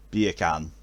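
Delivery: "Beer can" run together like Jamaican "bacon"; Jamaican accent. Shown as a demonstration. Trying out a Jamaican accent trick, "Beer can" for "bacon". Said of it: "for bacon in Jamaican accent".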